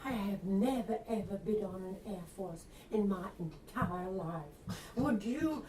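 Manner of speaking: put-on flirty Southern belle accent, sweet and coy. Reporter charming a PR man, in a Southern accent. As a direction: lay on a coquettish Southern accent. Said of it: "you're using a Southern accent".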